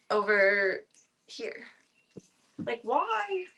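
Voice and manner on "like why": high, whining complaint at the cat; whiny why. Real, not acted. Whining at the cat about the bite. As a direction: plead with her, high and whiny.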